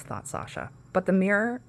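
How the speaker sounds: usual speaking voice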